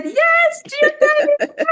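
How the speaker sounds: very high, excited squealing shout; joyful screaming. Retold or imitated. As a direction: scream it out in celebration. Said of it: "we started screaming and said".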